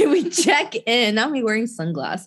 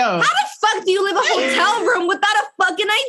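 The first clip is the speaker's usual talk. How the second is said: high, louder exasperated disbelief; incredulous outburst; 11 semitones higher, 3 dB louder than usual. Real, not acted. Friends turning up with no ID, high incredulous outburst. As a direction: throw it out in total disbelief.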